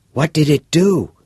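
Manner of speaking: put-on bright, curious teenage boy; a keen schoolboy pressing for details; eager boy voice. Todd asking.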